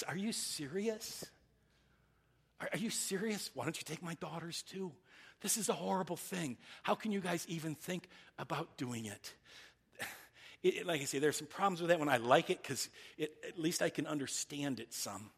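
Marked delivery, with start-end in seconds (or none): 0.0-9.3 incredulous. Retold or imitated. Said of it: "what he's saying is".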